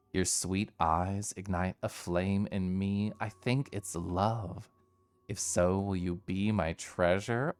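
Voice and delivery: slow, low romantic read; soft and seductive, drawn out; sultry. Acted. Reading a romantic comic line.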